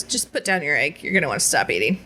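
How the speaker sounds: low, quick, firm warning; friend's low urgent warning; serious warning. Retold or imitated. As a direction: tell her to stop, dead serious. Friend warns her calmly.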